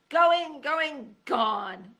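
loud, high ballgame call; announcer call. A narrator's reading. Sam calls the knight's fall like a baseball announcer, loud. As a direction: call it like a home run.

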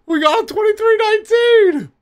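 very high imitation of the squeal; imitating squeaky voice. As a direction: copy the squeaky call. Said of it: "kinda close to that".

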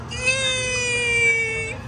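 high squealing shriek; shrieking jackpot winner. Real, not acted. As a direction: squeal with joy at the win. Jackpot win reaction.

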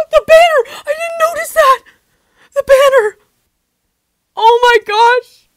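high shocked shout. Real, not acted. Sudden shocked realization.

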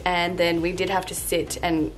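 normal talking voice

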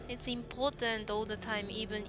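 ordinary talk

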